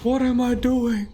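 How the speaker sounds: slow, high, lightly voiced old man; a bad hammed-up old geezer. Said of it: "I'm trying to look a stupid old man voice".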